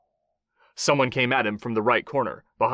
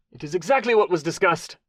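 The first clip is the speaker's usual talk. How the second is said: pleading; 7 semitones higher, 3 dB louder than usual. A narrator's reading. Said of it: "the Mexican pleaded".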